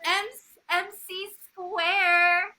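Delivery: high, slow hyped-up name call; playful announcer voice. Pretend. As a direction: announce the name like a hype man. Playful greeting of the guest.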